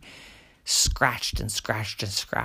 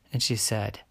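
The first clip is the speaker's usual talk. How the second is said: ordinary delivery, nothing marked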